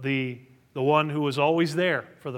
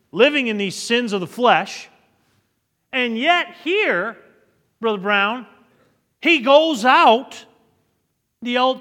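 preaching at full force; 9 semitones higher, 10 dB louder than usual. Acted. Pastor preaching to the church, loud and climbing. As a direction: lift the congregation, hammering each phrase.

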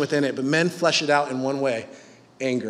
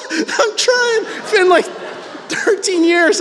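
exasperated pleading; 13 semitones higher than usual. Retold or imitated. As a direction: wail your defense like a worn-out husband. Retold exasperated plea to wife.

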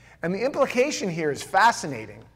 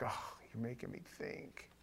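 whispered mock complaint; 5 semitones lower, 21 dB quieter than usual. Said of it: "was like".

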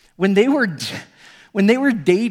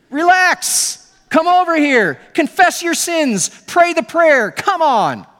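building from raised to much higher, loud and slow, impatient; hammed-up scolding preacher hurrying her along; mock pushy preacher; 7 semitones higher, 5 dB louder than usual. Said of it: "He doesn't say".

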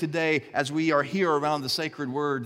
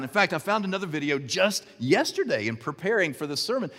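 usual speaking voice

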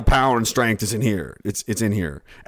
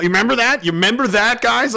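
taunting; 10 semitones higher, 4 dB louder, faster than usual. Real, not acted. Taunting audience.

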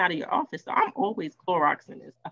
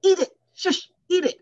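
a hint of French, pitched high and insistent; mock French chef shushing her to eat; French accent; 11 semitones higher, 5 dB louder than usual. French chef insisting she eat the croissant, playful French accent. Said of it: "strong French accent".

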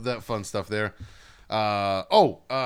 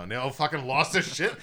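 normal talking voice

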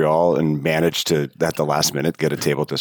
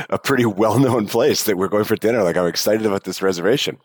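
ordinary delivery

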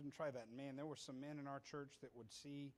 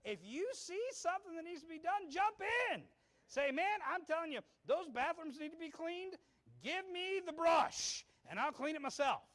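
full-force preaching; 14 semitones higher, 12 dB louder than usual. Real, not acted. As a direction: cry it out to the congregation at full power.

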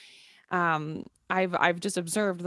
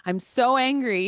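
loud, higher angry chant, demonstrated; half-playful show of blowing off anger; venting anger; 5 semitones higher, 4 dB louder than usual. Shown as a demonstration. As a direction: stretch the SO and push it out harder each time. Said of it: "I express that, uh, with my voice".

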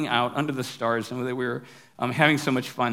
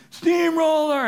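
high, loud mock yell; shrieky high mock battle cry; retold yell; 13 semitones higher, 5 dB louder, slower than usual. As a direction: imitate kids hollering in falsetto. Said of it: "then everybody's yelling".

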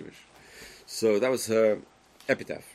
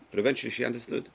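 ordinary talk

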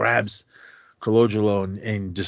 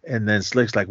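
normal talking voice

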